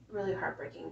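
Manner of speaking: whispered, holding back tears; hushed and fragile; near whisper. Real, not acted. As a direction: barely voice it, fighting tears. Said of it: "I'm probably gonna cry right now".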